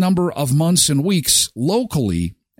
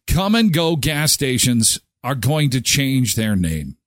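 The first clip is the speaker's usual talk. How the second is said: usual speaking voice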